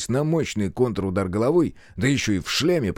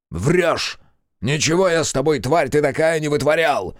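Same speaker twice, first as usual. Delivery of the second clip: raised, indignant retort; snapping back; 6 semitones higher, 4 dB louder than usual. A narrator's reading. Captive king denying his servant's accusation, snapping. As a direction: snap back at the liar. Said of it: "огрызнулся Улюлюль".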